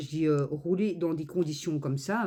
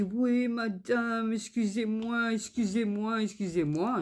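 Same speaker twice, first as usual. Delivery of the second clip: high, slow, drawn-out mock apology; mock apologetic courier; 6 semitones higher, slower than usual. Customer imitates the courier's grudging apology. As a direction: stretch the sorry like a sulky child. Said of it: "il me dit".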